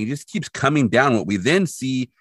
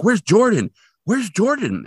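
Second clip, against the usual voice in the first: imitated worried cry, pitched much higher; mock urgent fear; 10 semitones higher, 3 dB louder than usual. Recapping Catherine repeatedly calling for Jordan, urgent mock alarm. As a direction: act out a mother's growing alarm. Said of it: "every time she sounds more urgent and fearful".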